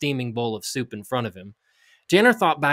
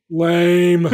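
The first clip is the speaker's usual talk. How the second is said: loud, higher mocking "Lame"; bored kid scoff; 4 semitones higher, 8 dB louder than usual. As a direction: sneer it like an unimpressed kid. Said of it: "Your kids are not going to go".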